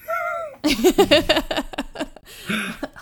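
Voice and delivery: loud, high burst of laughter; a long, high giggling laugh. Real, not acted. Players at the table over the candy flavor, loud high laughter.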